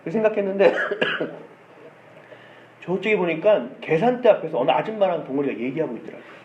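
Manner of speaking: normal talking voice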